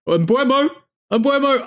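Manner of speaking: sudden high-pitched cry of the striker's name; excited shout. Real, not acted. Fan watching the match yelling at a chance, excited. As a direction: yell his name as the shot goes in.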